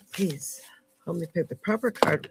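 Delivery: breathy whispered please; a whispered please over the cards. Real, not acted. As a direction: hush it like a small prayer. Soft plea while picking a card.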